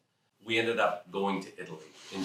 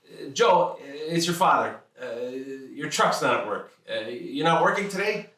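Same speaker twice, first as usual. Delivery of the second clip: higher, louder imitation of a blunt father; gruff father on phone; 6 semitones higher, 5 dB louder than usual. Father phoning his son about work, blunt higher louder voice. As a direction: play the pushy dad checking up.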